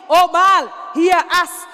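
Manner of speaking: loud pleading call to a god; calling out to Baal. Retold or imitated. Preacher voicing the prophets crying to Baal, loud calling. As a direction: cry out to the heavens for an answer.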